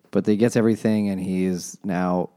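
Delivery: ordinary talk, nothing marked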